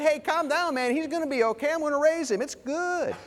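high-pitched, hammed-up calming down; breezy reassurance. Preacher imagining himself as Jesus telling mourners, breezy reassurance. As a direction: pitch it way up, mock-blurting the good news.

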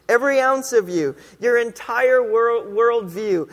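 full-force pulpit emphasis; preaching crescendo. Real, not acted. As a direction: rise to a preaching climax. Preacher building to a climax.

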